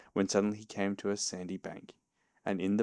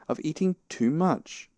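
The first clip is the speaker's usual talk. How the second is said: slow, raised rebuke; stern; 8 semitones higher than usual. A narrator's reading. Rabbit blaming Pooh's appetite, stern. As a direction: lecture slowly, leaning on each word. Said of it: "said Rabbit sternly".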